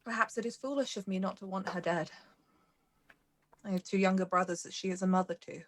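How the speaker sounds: soft, restrained confession with long pauses; composed noblewoman. Acted. Mara reflects on her brothers' mother's fate, quietly composed noblewoman. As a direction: confide quietly, holding feeling in.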